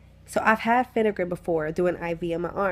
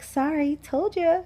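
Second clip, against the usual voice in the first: higher-pitched mock apology; mimicked unsympathetic nurse; 8 semitones higher than usual. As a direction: mimic a nurse's unapologetic told-you-so.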